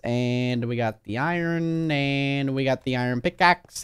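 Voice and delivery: ordinary talk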